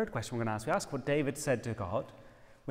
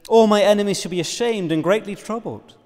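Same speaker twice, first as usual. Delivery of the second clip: loud proclamation, pitched up; declaring to enemies; 5 semitones higher, 13 dB louder than usual. Acted. David telling of his enemies' shame, loud and forceful. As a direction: ring it out against your foes.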